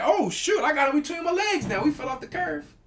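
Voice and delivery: high, bright-eyed gleeful inner voice; mock-giddy high voice; excited. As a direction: squeal it like you just caught him. Reliving his thrill mid-fight.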